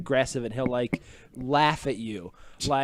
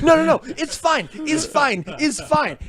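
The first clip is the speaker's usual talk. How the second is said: mock boss; 10 semitones higher, slower than usual. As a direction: brush it off like an impatient boss. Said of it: "He's like".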